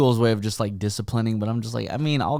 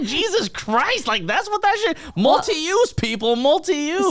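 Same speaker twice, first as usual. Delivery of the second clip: much higher, half-laughing disbelief; incredulous outburst. Retold or imitated. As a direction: act out amazed disbelief. Retelling own shocked reaction.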